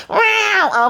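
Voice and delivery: high-pitched drawn-out meow; mock cat yowl. Vlogger mocking a catfight in her recap, high yowled meow. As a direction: let out a high catty meow.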